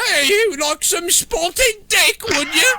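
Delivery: high, slow put-on posh English voice; mock English accent. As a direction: ham up a snooty Englishman offering food. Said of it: "you know how those English are".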